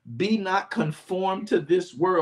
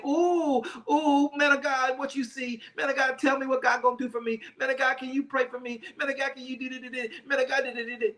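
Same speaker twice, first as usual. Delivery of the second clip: mocking needy churchgoer; 6 semitones higher than usual. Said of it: "run after him and say".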